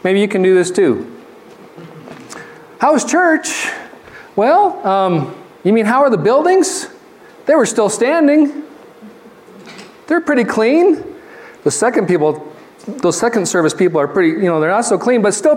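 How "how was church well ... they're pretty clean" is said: mock-innocent, higher voice; playful teasing. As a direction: play dumb to tease your wife. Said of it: "I'm gonna mess with her a little bit".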